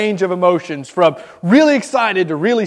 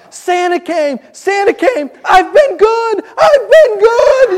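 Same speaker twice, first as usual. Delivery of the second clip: put-on high, squealing little girl, building louder and slower; imitated child's squeal; 12 semitones higher, 6 dB louder, slower than usual. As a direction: squeal it like a thrilled kid on Christmas morning. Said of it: "she squeals at the top of her lungs".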